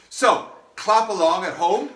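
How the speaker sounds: upbeat invite. Real, not acted. Calling to viewers at home.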